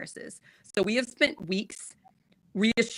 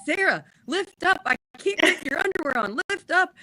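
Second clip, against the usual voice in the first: husband's instruction; 9 semitones higher than usual. Retold or imitated. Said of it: "And he's like".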